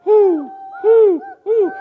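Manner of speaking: high, loud whooing wind sound; playful wind noise for the kids; wind whooshing. Acted. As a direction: call out like wind sailing high. Voices the wind for children.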